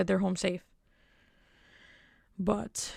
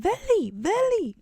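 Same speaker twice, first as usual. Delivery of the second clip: high, louder mock scream; retold scream, pitched way up; 13 semitones higher, 4 dB louder, slower than usual. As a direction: call the name out like a yell. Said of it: "She said".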